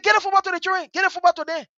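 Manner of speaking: high-pitched repeated order; insistent retold command. Big sister ordering football given to children, high insistence.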